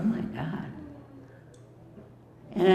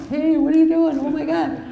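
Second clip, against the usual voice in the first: high, loud alarmed yell; panicked group yelling, much higher and louder; retold yelling; 11 semitones higher, 6 dB louder than usual. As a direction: shout it like startled people at the table. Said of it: "everybody started yelling".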